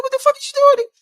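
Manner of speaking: very high, loud mock woman's complaint; hammed-up high woman's voice; shrill girlfriend. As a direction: squeal it like an annoyed girlfriend. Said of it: "She be like".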